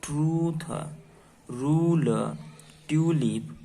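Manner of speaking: each word said clearly with long pauses; teacher reading long u words slowly. Shown as a demonstration. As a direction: read the words for the class to copy. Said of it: "यू के sound पर से".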